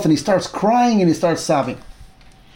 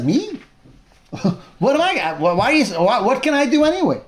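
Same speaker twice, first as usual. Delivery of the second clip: higher-pitched, baffled "who, me?"; incredulous protest; 6 semitones higher than usual. Acted. Chazael reacts to the prophecy.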